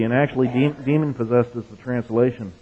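normal talking voice

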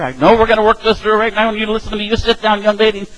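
high-pitched, insistent mock scolding; stern dad. Father arguing with his worked-up teenage daughter, stern mock scolding. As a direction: lay down the law like an exasperated father. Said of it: "argue with my teenage daughter".

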